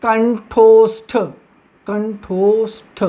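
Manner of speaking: slow low teacher pronunciation of a new term; slow syllable demo. Shown as a demonstration. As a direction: spell out कंठोष्ठ by sound, kanth-oshth. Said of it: "ये हो जाएगा हमारा".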